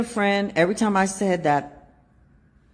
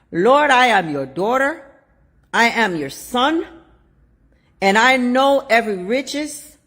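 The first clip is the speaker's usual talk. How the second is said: earnest prayer; 3 semitones higher, 4 dB louder than usual. Acted. Models a prayer for listeners.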